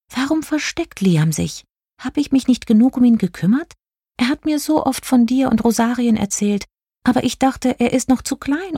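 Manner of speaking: high-pitched fairy fretting; high, light character voice full of self-doubt; worried star fairy. A narrator's reading. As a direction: fret aloud as a little star fairy. Narrator voices Jelina the star fairy.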